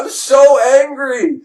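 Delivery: loud, slow, high-pitched cry; angry outburst. Acted. Prophetess breaking off laser noises, comically furious. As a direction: stretch out the rage, hammed-up. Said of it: "she's really pissed off".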